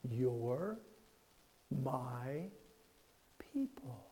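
slow, fading down into a whisper. Retold or imitated. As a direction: lean in and breathe the last word. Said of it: "and she said this".

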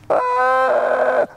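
long high "ah" of mock dread; mock panicked wail. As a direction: let out a drawn-out high wail. Acts out the panicking, whining Israelites.

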